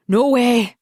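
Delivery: loud, flat refusal; protesting. A narrator's reading. Husband refusing counselling, loud protest. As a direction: shut the idea down hard. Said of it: "Daniel protests".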